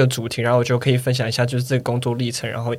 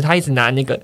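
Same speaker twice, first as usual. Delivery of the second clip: ordinary talk, nothing marked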